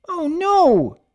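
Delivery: high, loud gasp of dismay; filly spotting the ruined book; dismayed cry. Acted. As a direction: cry out like you ruined something.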